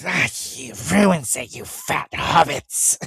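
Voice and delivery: slow creature whine; lightly voiced Gollum. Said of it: "Gollum voices".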